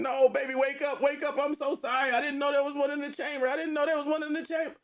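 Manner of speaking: high, wailing mother's plea; retold sobbing plea. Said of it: "you hear her coming in".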